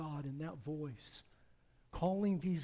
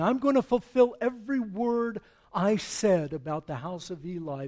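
thundering judgment; 5 semitones higher, 9 dB louder than usual. Acted. God pronouncing judgment on the house of Eli, thundering. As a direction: boom it out like a divine verdict.

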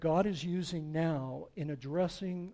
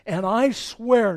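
loud, slow, higher sworn oath; divine oath; 7 semitones higher, 16 dB louder, slower than usual. Acted. God swearing judgment against Eli, thundering. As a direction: proclaim it like a raised hand to heaven. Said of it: "when God raises his hand and swears".

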